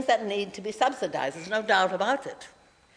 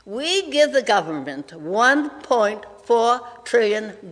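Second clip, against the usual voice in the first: slow, measured phrases with clear pauses; deliberately slow. Real, not acted. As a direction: spell the figures out slowly for the listeners. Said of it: "I'll say it slowly".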